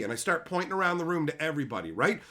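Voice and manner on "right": ordinary delivery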